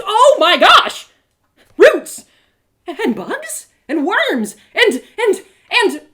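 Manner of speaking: high, loud, breathless wonder with a laugh; amazed outcry. Acted. Girl seeing the spirit world cries out in amazement. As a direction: gasp out each discovery in delight.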